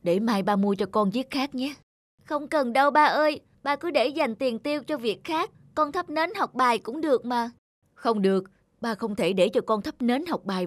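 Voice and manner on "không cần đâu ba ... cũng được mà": put-on higher, sweet child speaking to father; a small good child reassuring dad; young child voice. Child telling father not to buy a lamp, gentle.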